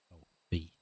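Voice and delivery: ordinary talk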